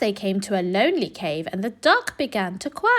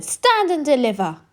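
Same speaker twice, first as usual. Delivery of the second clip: higher and louder robber call; thundering demand; 6 semitones higher, 3 dB louder than usual. A narrator's reading. Rat robber halting the squirrel, thundering. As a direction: thunder it like a highwayman. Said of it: "who thundered".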